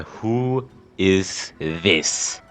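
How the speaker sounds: ordinary talk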